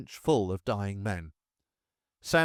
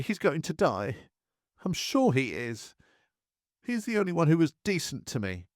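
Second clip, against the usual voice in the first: a higher, tearful plea that he'll die; mild higher-pitched blubbering, in tears; 6 semitones higher than usual. A narrator's reading. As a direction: weep it out, voice lifting in grief.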